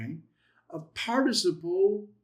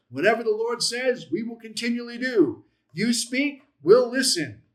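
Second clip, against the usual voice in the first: higher, eager vow, landing loud on "we'll listen"; "You speak, we'll listen" pitched up and firmly punched; emphatic pledge; 7 semitones higher, 6 dB louder than usual. Acted. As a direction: declare "we will continually do" with full commitment. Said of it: "All of it is cohortative".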